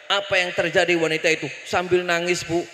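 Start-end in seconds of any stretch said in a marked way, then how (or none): none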